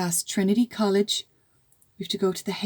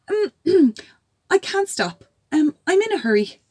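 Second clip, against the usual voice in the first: high, slightly nervous brave voice; bravest voice; 8 semitones higher, 4 dB louder than usual. Acted. Small girl facing a giant, bravest voice. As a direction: sound brave while scared. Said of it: "said in her bravest voice".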